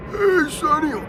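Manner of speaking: put-on high, raspy old-timer voice; raspy old man voice. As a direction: croak it like an old smoker. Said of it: "raspy sort of".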